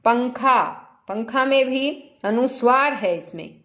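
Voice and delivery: ordinary talk, nothing marked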